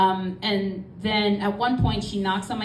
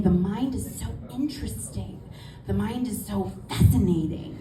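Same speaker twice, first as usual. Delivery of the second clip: dreamy musing. Retold or imitated. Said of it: "She would just say it like that".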